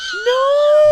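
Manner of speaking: high, loud drawn-out "no"; gasp, then wail it loudly; shocked cry. Real, not acted. Streamer reacting in horror to a clip, shocked cry.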